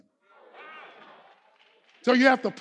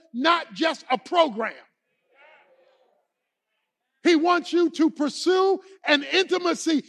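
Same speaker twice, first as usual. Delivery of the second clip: raised, emphatic pulpit voice; impassioned preaching; 6 semitones higher, 6 dB louder than usual. Real, not acted. Preacher telling the congregation God wants their love, impassioned. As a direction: preach it with force, leaning on key words.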